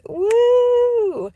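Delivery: high, loud whoop of relief; relieved whoop. Real, not acted. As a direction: let out a cheer. Relief cheer.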